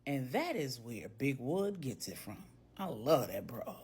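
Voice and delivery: low, soft private aside; amused murmur to himself. A narrator's reading. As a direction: say it under your breath, tickled. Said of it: "He said to himself".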